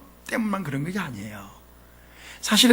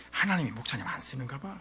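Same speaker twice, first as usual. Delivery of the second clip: lower, softer, fast snide remark; mock naysayer; 9 semitones lower, 9 dB quieter, faster than usual. Church member discouraging the pastor, quick snide mutter. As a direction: toss off the jinx lightly. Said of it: "어떻게 초를 치느냐?".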